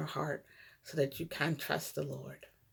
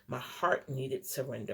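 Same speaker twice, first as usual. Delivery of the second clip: ordinary talk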